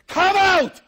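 piercing high shout; shouted command. Acted. Preacher voicing Jesus shouting come out. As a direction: bellow it at every grave. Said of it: "If he would have said".

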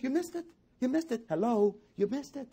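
very high, incredulous repeat; incredulous hammering. Retold or imitated. Preacher voicing "you missed it" to the congregation, high and incredulous. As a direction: pitch it way up as if pointing out the obvious.

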